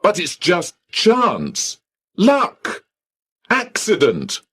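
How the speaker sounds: high, rising indignant outburst; angry man arguing with the receptionist, high indignant; angry protest. Acted. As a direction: pile up the complaints, pitched high. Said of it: "Then I became angry".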